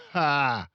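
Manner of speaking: loud, high scoffing ha; scornful ha. Acted. Father scoffing at his daughter, mocking ha. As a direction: bark out a mocking ha. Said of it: "the father said".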